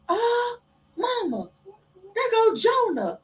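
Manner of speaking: put-on high, slow small-child voice pointing out a ghost; a young boy calling his mama, high and slow; little boy voice. Son tells mama he sees Jonah, high child voice. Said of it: "And Rain was like".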